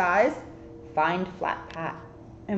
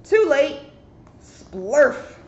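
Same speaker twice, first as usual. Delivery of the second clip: dramatic build; 5 semitones higher, 5 dB louder, much slower than usual. A narrator's reading. Narrator builds to splat sound.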